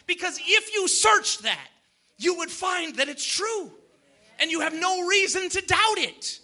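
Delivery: ordinary delivery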